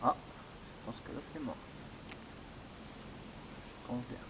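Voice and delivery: hushed whisper. Real, not acted. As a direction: whisper so nothing is disturbed. Hushed during ghost hunt.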